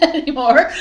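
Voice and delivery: giggles through the word; laughing at her own claim. Real, not acted. Laughing at herself after saying she has no bloopers.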